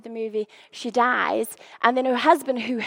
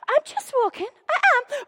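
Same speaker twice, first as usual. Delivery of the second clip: mock innocent; 11 semitones higher than usual. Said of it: "So it's like".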